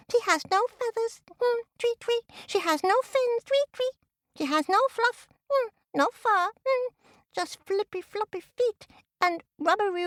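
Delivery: very high, chirpy little bird voice; tweeting baby chicks. A narrator's reading. Chicks marvel at the tied-up turtle, squeaky tweeting. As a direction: squeak and twitter in amazement. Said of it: "she could hear twittering and tweeting".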